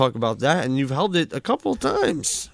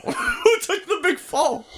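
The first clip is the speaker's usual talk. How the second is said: laughing; slower than usual. Real, not acted. Laughing while asking.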